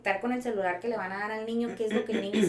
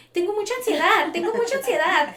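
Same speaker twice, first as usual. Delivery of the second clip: put-on high, loud childish whine, much higher than her own voice; imitating a little kid; 11 semitones higher, 9 dB louder than usual. Therapist mimics small patients claiming anxiety, high loud child voice. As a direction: mimic a four-year-old complaining, loud and high. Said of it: "llegan niños de cuatro años y me dicen".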